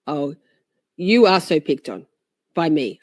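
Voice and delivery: ordinary talk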